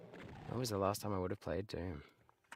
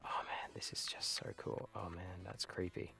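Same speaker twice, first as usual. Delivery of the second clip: hushed, breathy with wonder; awed whisper; 8 dB quieter than usual. Real, not acted. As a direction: whisper it like you're amazed. Awed by creepy game level.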